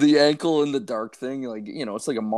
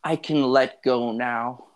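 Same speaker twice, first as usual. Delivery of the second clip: slow, drawn-out goodbye, hammed-up; mock deathbed farewell; 2 semitones higher, much slower than usual. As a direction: play it like your last words, half joking. Mock farewell while feverish.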